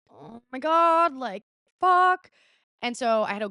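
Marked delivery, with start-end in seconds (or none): 0.1-2.3 high, drawn-out dismay. Retold or imitated. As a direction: groan at the bad news. Cop is coming in.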